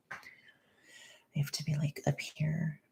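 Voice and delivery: hushed whisper, very soft; slow whisper close to the mic; quiet. Real, not acted. Streamer trying not to wake mom, whispering. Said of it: "I wanna be quieter".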